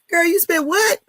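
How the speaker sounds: high, loud mock outrage; shocked mom. Mother pretending to scold her daughter over spending, high, loud mock shock. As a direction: pretend to catch your kid overspending.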